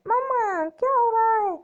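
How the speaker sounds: put-on a child wailing for mama as the witch grabs it; screaming children. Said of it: "उसके बच्चे चिल्ला रहे हैं". (बच्चे मम्मा को पुकारते हुए, डर से ऊँची चीख)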